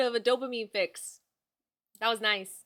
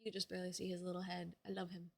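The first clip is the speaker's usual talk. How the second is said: soft murmur; 4 semitones lower, 14 dB quieter, faster than usual. Real, not acted. Cooing softly at dog.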